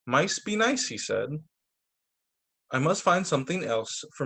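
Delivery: put-on lively storybook voice for kids; bright read-aloud narration; reading to children. Reads picture book aloud.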